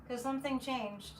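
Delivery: ordinary delivery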